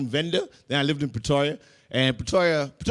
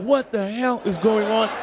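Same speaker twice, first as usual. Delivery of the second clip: shocked; 6 semitones higher than usual. Retold or imitated.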